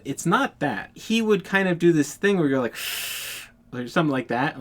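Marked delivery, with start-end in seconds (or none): 2.8-3.4 imitated angry hiss through the teeth. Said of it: "like hissing through his teeth".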